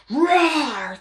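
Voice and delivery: hammed-up little monster roar; mock roar. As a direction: roar like a grumpy monster, half in jest. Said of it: "been like".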